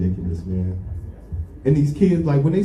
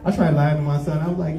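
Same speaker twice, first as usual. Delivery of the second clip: ordinary talk, nothing marked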